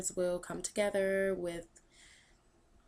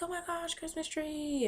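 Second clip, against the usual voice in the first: imitating excited daughter; 10 semitones higher than usual. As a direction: imitate an excited child in a high voice. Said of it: "She was excited".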